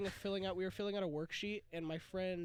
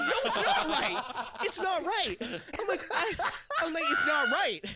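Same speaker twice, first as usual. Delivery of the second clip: high, loud laughing protest; laughing shout; 9 semitones higher, 9 dB louder than usual. Retold or imitated. Insisting to group answer is wrong, loud laughing shout. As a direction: shout it through laughter, exasperated.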